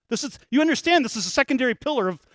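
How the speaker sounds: rising to a loud, high, slowed preaching climax; fiery preaching. Acted. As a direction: hammer the point home from the pulpit.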